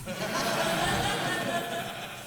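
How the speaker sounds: high breathy cackle; high wheezing laugh. Real, not acted. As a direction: let out a squealing laugh. Big laugh at own bit.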